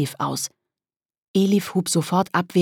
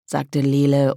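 ordinary delivery, nothing marked

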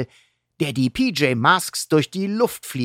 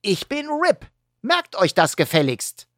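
put-on bossy wolf kid; 9 semitones higher than usual. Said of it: "sagt Rip".

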